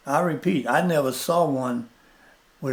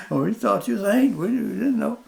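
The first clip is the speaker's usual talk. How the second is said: protesting excuse; 9 semitones higher, 3 dB louder, faster than usual. Retold or imitated. Boys' excuse to friend, retold.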